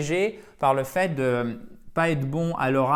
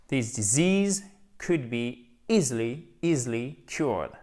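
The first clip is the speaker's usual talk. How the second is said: slow, careful model reading; slow model sentence; slower than usual. Shown as a demonstration. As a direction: say it slowly for learners to copy.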